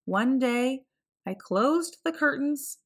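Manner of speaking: plain reading voice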